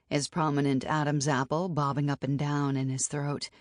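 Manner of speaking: usual speaking voice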